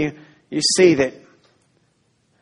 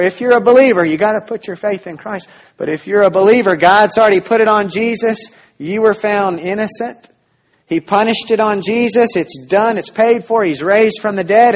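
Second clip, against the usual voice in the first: louder, higher, driving the point home; preaching at full force; 6 semitones higher, 5 dB louder than usual. Acted. Pastor urging the congregation, full-force preaching. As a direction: preach it with growing fervor.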